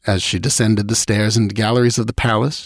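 ordinary delivery, nothing marked

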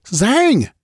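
high-pitched call for someone far off. A narrator's reading. Queen calls the priest's name into the globe, calling out. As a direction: send the name out high and insistent. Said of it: "she called".

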